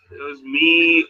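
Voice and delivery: usual speaking voice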